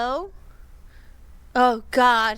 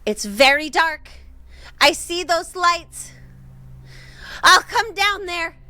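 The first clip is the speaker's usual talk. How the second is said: calling out; 7 semitones higher, 5 dB louder than usual. Retold or imitated. Retelling a lost woman calling.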